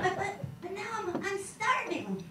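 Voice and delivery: put-on high, slow childish whine about starving; whiny child. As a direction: whimper like a hungry little kid. Child whining to teacher.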